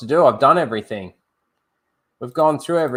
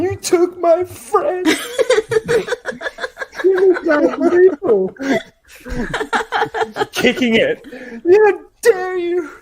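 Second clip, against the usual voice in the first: much higher, slow, laughing mock anguish; mock grieving rage; 6 dB louder, slower than usual. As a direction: ham up a friend avenging a tree.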